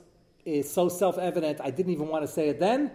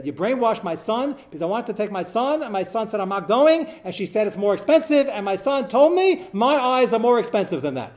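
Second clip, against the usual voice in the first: indignant mother; 6 semitones higher, 5 dB louder than usual. Retold or imitated. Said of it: "it came to a feverish pitch".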